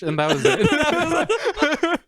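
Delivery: high, slow, cracking with laughter; laughing through the punchline. Real, not acted. Punchline of text story.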